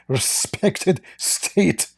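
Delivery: high, slow mock pompous voice. Mocks clip's wording.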